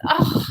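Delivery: long, exaggerated groan of disdain; hammed-up "ugh" with a big high sigh; disgusted sigh. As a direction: sigh theatrically in mock disgust. Acted disdain at the neighbors.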